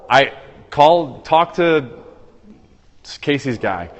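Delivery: ordinary talk, nothing marked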